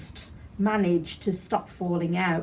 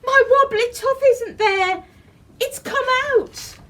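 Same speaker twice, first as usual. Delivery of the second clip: put-on high, loud child's shout; very high shouting little girl; 6 dB louder than usual. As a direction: call out in alarm as a child. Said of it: "they heard Gracie shout".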